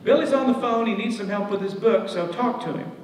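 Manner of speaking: high, loud calls of "Ned!" in two syllables, fading on the last words; wife summoning Ned to the phone, high and loud; calling across the house. Retold or imitated. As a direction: yell for him from the kitchen. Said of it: "it's two syllables".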